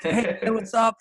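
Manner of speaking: high-pitched retold fan hello; eager fan greeting. Fan walking up to a famous guitarist, eagerly greeting him. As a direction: greet your idol eagerly, voice lifted high.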